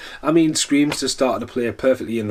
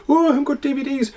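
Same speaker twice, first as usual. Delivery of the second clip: high, fast mock panic, much higher than his own voice; mocking panicked people; 13 semitones higher, faster than usual. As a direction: squeal it like a helpless whiner with no idea. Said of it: "Everyone's like".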